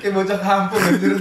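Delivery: tertawa sambil mengejek. Real, not acted. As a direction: ucapkan sambil ngakak. Mocking rough players, laughing. (tertawa keras)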